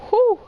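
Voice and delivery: high, loud pained whew; sharp pained exhale. Real, not acted. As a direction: blow out through the cramp. Leg cramp pain.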